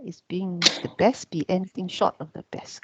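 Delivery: whispered, voiceless, no pitch; hushed whisper of the same question. Shown as a demonstration. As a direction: breathe the question out under your breath. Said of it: "This is a question".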